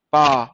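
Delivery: teacher modelling पा slowly; long paa. Shown as a demonstration. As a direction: say पा holding the vowel long. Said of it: "एक word बन रहा है पा".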